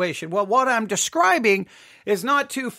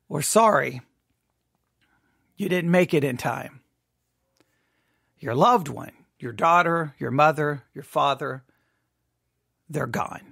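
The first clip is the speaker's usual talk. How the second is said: low and hushed, heavy pauses; somber, grieving; 7 semitones lower than usual. Acted. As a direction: break the news of a death gently and low. News of a loved one's death.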